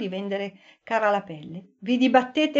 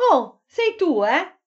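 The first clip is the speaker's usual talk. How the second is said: surprised; 6 semitones higher, 3 dB louder, slower than usual. A narrator's reading. Jim waking, surprised.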